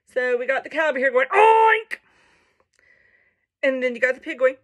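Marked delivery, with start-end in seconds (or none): 1.3-2.0 high, loud, drawn-out oink; loud cow oink. Acted. Reader voicing the cow's attempt, loud high oink. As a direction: belt out a long oink, pitched way up. Cow in story tries oinking.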